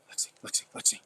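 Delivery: breathy whisper of the dog's name; hushed call to the dog. Real, not acted. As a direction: call her name under your breath.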